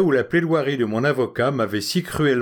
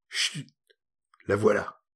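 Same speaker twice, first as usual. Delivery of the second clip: soft hushing breath; a quick hushed warning; 4 semitones lower, 5 dB quieter than usual. Acted. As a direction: hush him as she comes near. Conspirator hushes as girl approaches.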